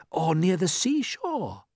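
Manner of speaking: put-on high, drawn-out boy's voice; eager. A narrator's reading. James guessing where they are, eager and high. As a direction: pipe up hopefully. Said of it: "said James eagerly".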